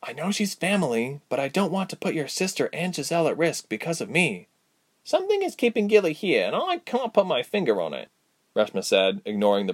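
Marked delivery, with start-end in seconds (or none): 0.0-4.5 lightly voiced heroine, a little hushed; higher, gently insistent character voice. 5.0-8.1 high-pitched character voice, musing; higher, lilting friend's voice; Reshma, puzzled. Acted.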